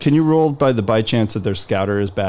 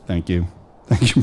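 ordinary talk

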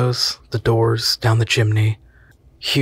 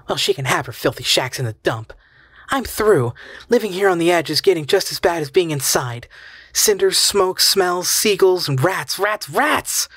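rising hysteria; 7 semitones higher than usual. Acted. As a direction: pitch climbing higher into hysteria. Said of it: "Her voice rose hysterically".

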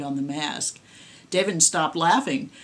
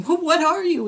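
high, loud terrified cry; cried out in terror; 11 semitones higher, 6 dB louder than usual. A narrator's reading. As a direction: scream the question in sheer fright. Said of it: "he cried out".

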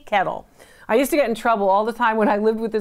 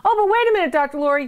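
high-pitched mock interruption; acted-out objection starting high; objecting viewer; 7 semitones higher, much faster than usual. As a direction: jump in as a protesting viewer, pitched way up.

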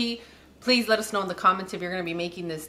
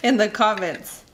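loud call to viewers; 4 semitones higher, 6 dB louder than usual. Real, not acted. Calls out to viewers.

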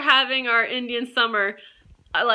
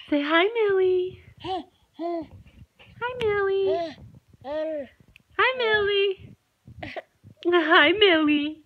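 put-on baby talk; 8 semitones higher, much slower than usual. Mother prompting baby to greet dog.

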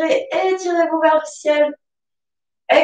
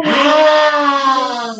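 lion roar. Shown as a demonstration. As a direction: roar it out on the exhale, tongue out. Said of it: "Et on va rugir comme un lion". (rugissement de lion, fort)

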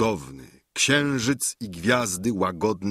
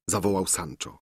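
ordinary delivery, nothing marked

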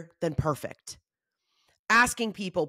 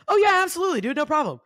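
breezy eager yes; 6 semitones higher, 5 dB louder, faster than usual. Retold or imitated. Others breezily agreeing to her request.

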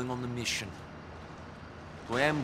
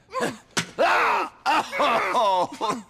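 loud defiant laugh; 10 semitones higher, 8 dB louder than usual. Acted. Prisoner taunts interrogator.

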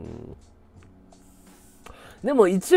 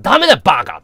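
loud scolding shout; angry yell; 3 semitones higher, 9 dB louder, much faster than usual. Retold or imitated. Boss shouting down Sano's request, angry yell. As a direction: snap it out loud, fed up. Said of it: "つって".

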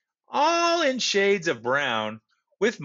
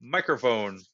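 usual speaking voice

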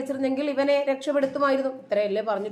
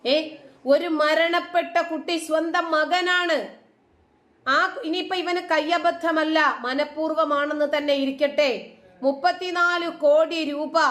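raised pitch, forceful and emphatic; indignant tirade; 4 semitones higher, 4 dB louder than usual. Real, not acted. Vlogger challenging who fixed the blood money, indignant. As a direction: build an outraged challenge at high pitch.

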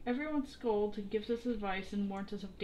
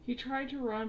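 plain reading voice

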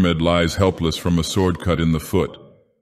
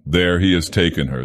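ordinary delivery

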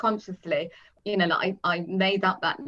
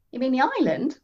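questioning; 6 semitones higher than usual. A narrator's reading. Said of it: "I asked".

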